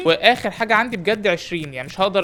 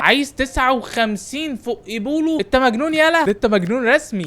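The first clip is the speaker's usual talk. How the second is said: outraged shout; 6 semitones higher than usual. Real, not acted. Manager shouting at a greedy player, outraged. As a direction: bark it out like the price is an insult.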